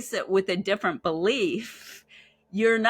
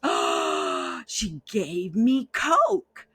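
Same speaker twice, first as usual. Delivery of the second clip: slow, hushed sudden realization; gasp of realization; much slower than usual. Retold or imitated. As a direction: let it dawn on her slowly.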